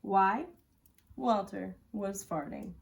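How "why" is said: ordinary delivery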